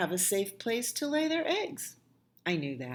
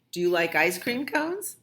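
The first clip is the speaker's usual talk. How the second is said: ordinary delivery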